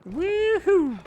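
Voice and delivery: high whooping cheer; excited cheer. Real, not acted. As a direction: whoop with joy. Cheering in game.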